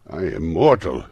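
loud pleading protest; remonstrating. A narrator's reading. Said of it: "Scrooge remonstrated".

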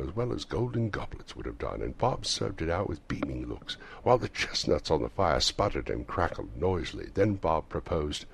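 usual speaking voice

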